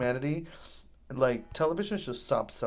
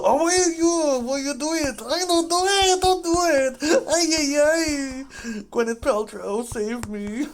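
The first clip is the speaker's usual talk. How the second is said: high, loud hammed-up wail; exaggerated mock crying, much higher; 9 dB louder than usual. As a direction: wail at the screen like a melodrama. Wailing at the game.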